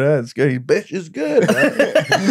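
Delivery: high, delighted impression through laughter; Tom Stade. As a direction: cheer on the show in a high put-on voice. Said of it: "you doing an impression of him".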